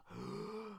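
imitated hushed gasp of awe; awed gasp. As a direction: mimic a crowd's soft, awestruck intake of breath. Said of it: "make sure you give him a awed hush".

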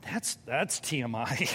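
flustered prim put-down; mock flustered. Churchgoer flinching at the pastor's overshare, mock flustered. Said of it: "And they go".

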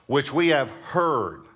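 "heard" stretched and strong, falling at the end; slow down and land hard on "heard"; stress on heard. Shown as a demonstration. Preacher underlining a word as he reads, stress on "heard". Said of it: "I underline the word heard".

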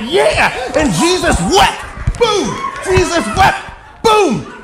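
hammed-up preacher's shout, very high and loud; explosive, overzealous prayer shouting; frenzied mock prayer. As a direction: bellow each phrase slowly like a faith healer. Re-enacts shouted prayer, pushing her head.

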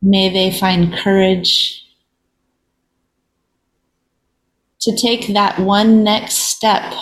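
unhurried meditation voice with long silences; guided reflection. Real, not acted. Host leading listeners in a moment of pause, meditative. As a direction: lead gently, leaving long pauses between phrases.

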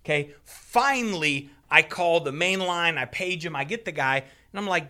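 ordinary talk, nothing marked

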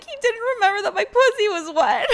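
high, loud hammed-up wail; mock crying. Vlogger mimicking contestant crying in the bathroom, mock wailing. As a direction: imitate a tearful wail, laughing underneath. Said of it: "she runs off crying in the bathroom".

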